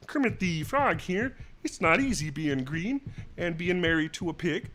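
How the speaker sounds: put-on frog puppet voice; Kermit the Frog. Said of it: "I used to be able to do".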